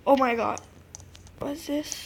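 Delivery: ordinary delivery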